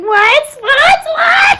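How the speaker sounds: very high, loud squealed question; incredulous laughing shout; shrieked disbelief. Retold or imitated. Reacts to surprising DM.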